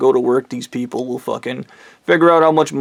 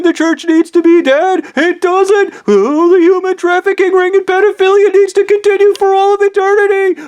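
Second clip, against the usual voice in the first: very high, louder hysterical scream; retold screaming; 5 dB louder than usual. Speaker raging at the wives about the church, screaming. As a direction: keep shrieking over everyone's objections. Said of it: "Stop screaming!".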